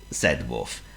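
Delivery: ordinary delivery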